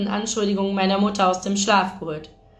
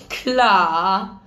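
one long, stretched-out word with a dipping tune; drawn out; 4 dB louder than usual. A narrator's reading. Friend answering a claimed lack of interest, drawn out. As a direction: draw the word right out. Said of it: "sagte sie gedehnt".

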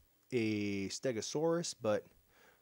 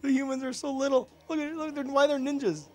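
very high, giddy squeal; amused disbelief; 7 dB louder than usual. Real, not acted. Player spotting tiny ninja humans, in amused disbelief. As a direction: squeal it between fits of laughter. Delighted at tiny enemies, laughing around.

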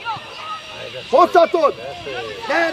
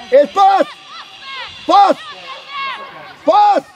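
shouted call; 4 semitones higher, slower than usual. Real, not acted. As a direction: yell for the ball across the pitch. Coach calling for a pass.

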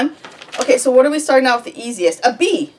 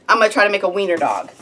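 usual speaking voice

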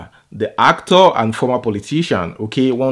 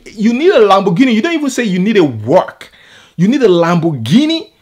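raised, high-pitched incredulous scolding; indignant disbelief; 6 semitones higher, 4 dB louder than usual. Real, not acted. Vlogger scolds the man who wanted a Lamborghini, indignant. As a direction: throw the demand back at him, outraged. Outraged rant at the kicker's demand.

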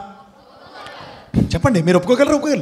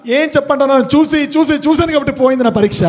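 high, rushed comic whine of protest; protesting son; 8 semitones higher than usual. Retold or imitated. Son answering father about failed exam, exasperated protest. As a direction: hurl the excuse back in a high voice.

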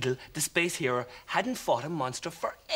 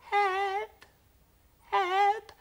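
very high, tiny frightened alien voice; trembling little voice; 4 dB louder, slower than usual. Acted. Little creature calling to the space hero for help, very high and trembling. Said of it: "the trembling little voice called back".

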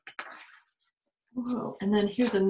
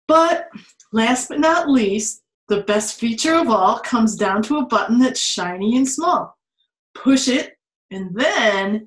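put-on lively read-aloud to children; 6 dB louder than usual. Teacher reading a picture book to her class, lively. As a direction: read it out with a storyteller's lift.

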